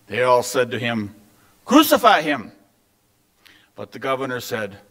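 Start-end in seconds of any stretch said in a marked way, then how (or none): 1.6-2.5 raised, higher-pitched cry; narrator's controlled shout; crowd shouting. A narrator's reading. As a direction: call it out like a demanding crowd. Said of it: "kept shouting even louder".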